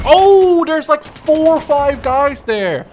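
loud, high alarmed yell, slowing on the count; startled shout. Real, not acted. Gamer spotting a crowd of enemies, alarmed shout. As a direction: shout out the shock of seeing them. Spots enemy group in game.

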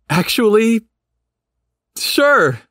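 high, eager yes; eager delight. A narrator's reading. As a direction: jump at the offer happily. Sudden happy agreement.

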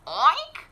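storybook pig oink. Acted. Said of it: "said Pig and Pig".

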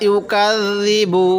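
ইউকাযযিবু recited slowly with long vowels; drawn-out recitation. Shown as a demonstration. Teacher to students, slowly recites ইউকাযযিবু. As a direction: chant ইউকাযযিবু in tajweed style. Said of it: "Quran recitation demo".

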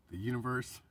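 ordinary talk, nothing marked

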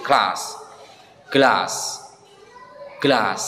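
glass with a long back ah; teacher demo of British glass. Shown as a demonstration. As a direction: say glass as glahs. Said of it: "British English itu dibaca glass".

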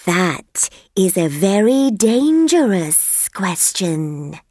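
slow, unhurried snake voice; lazy snake. Acted. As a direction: let the sleepy snake take its time. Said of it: "it said".

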